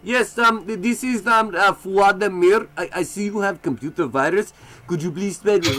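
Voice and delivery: playing a fake Eastern European tech support caller, hesitant; scam caller accent. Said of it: "the fucking calls I used to get".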